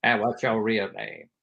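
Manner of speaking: slow, measured imitation of a famous actor; Morgan Freeman. Star asks bit player his name, slow imitation. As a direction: draw it out with kindly authority. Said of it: "And then Morgan goes".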